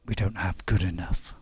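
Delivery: low-voiced exacting character; lightly put-on low voice, correcting; Ghost. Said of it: "doing a very good impression of Ghost".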